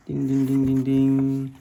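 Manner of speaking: sung-out ringing dings on one pitch; imitated doorbell. A narrator's reading. Reader ringing the Watsons' bell, imitated doorbell. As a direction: run the dings together like a bell.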